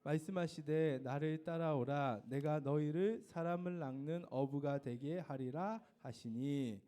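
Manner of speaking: slow, measured choral recitation. Shown as a demonstration. Teacher reciting the memory verse with the class, slowly. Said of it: "시작".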